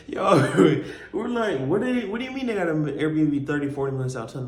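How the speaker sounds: high, disbelieving question; can't believe what he's hearing; incredulous. Retold or imitated. Friends hear the girls booked far away, incredulous.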